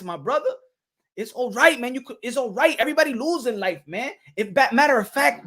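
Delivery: normal talking voice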